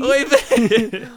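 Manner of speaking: high Yiddish oy vey, laughing; laughing Yiddish-accented oy vey. Pretend.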